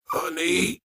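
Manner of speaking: dazed guard croaking a single word; croaked. A narrator's reading. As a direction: croak it out from the hospital bed. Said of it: "he croaked".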